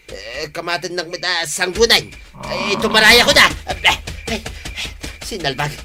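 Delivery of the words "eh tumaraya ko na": loud, raised cry of alarm; panicked escape. Acted. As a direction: blurt it out as you bolt from danger. Fleeing the charging carabao. (mabuteng nga agtaray)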